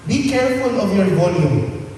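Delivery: ordinary delivery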